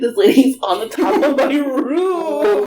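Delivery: high, slow wail breaking into laughter; hammed-up horrified realization; mock panic. Aunt with a lady on her roof, wailing in mock panic.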